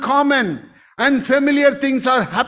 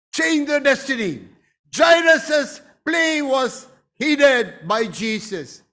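ordinary delivery

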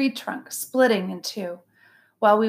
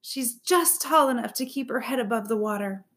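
plain reading voice

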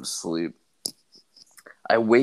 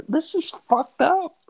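high squeaky dismay. Retold or imitated. Retold dismay.

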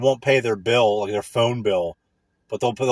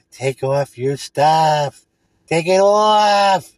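slow, leering creep voice, louder on the second line; creepy come-on; 3 semitones higher, 5 dB louder, much slower than usual. Pretend. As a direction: drag it out like a sleazy creep. Creepy things you can say.

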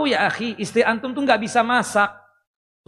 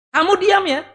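harsh husband; 7 semitones higher, 5 dB louder than usual. Retold or imitated. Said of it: "Ucapan yang tidak baik seorang suami".

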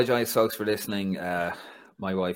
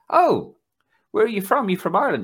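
high, loud, eager Italian stranger; imitating Roberto; 12 semitones higher, 7 dB louder than usual. Imitating Roberto greeting him with a much higher, louder voice. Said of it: "came up to me and he goes".

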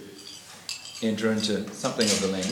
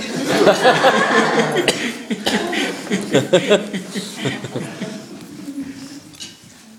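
long, high, loud laughing fit; 13 semitones higher, 7 dB louder than usual. Real, not acted. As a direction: crack up at length. Laughing at nephew's question.

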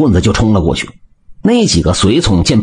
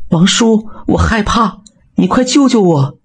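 put-on high, frightened girl's cry for help; panicked plea; 11 semitones higher than usual. A narrator's reading. Frightened young woman begs the blacksmith for rescue. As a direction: beg your uncle to save you. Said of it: "惊慌地说道".

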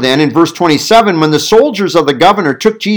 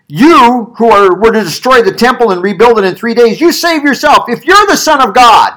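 loud, high jeering taunt; mocking; 8 semitones higher, 5 dB louder than usual. A narrator's reading. People taunting Jesus on the cross, loud mocking. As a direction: jeer as a hostile crowd. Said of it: "they were mocking him".

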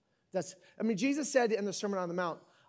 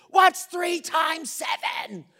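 slow, loud, higher mock shout of a dad; retold dad yell; 8 semitones higher, 6 dB louder, much slower than usual. Father yelling a math question at his child, mock shout. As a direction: bark it slowly like a fed-up dad. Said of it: "with your dad screaming at you".

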